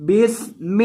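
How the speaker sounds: باسم with a whistling hiss on the س; whistled seen. Shown as a demonstration. Teacher demonstrating the whistling س in باسم. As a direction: say باسم joining ب to the hissing س, no alif. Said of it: "سین کے اندر سیٹی کی آواز".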